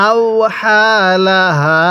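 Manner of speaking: very slow, drawn-out syllables; model recitation. Shown as a demonstration. Teacher models Quran words for learners, slow measured recitation. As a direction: stretch each long vowel evenly. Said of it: "এরকমভাবে পড়ব".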